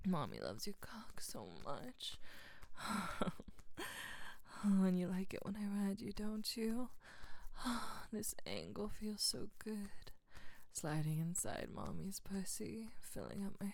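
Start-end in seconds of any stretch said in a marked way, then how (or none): none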